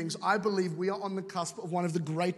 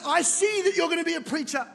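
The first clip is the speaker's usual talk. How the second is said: a raised, higher-pitched voice, prophesying; prophesying woman; 8 semitones higher, 4 dB louder than usual. Retold or imitated. Quoting Heidi Baker prophesying over him as a raised, higher proclamation. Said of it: "she goes".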